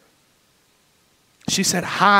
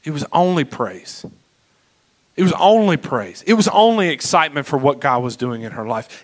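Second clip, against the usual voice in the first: ordinary delivery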